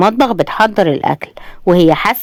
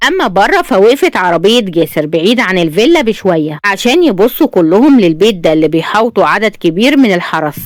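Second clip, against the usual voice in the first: usual speaking voice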